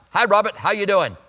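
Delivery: imitating a New England friend's Boston accent. Boston friend greeting the preacher by name, Boston accent. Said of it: "I have a friend who is from Boston".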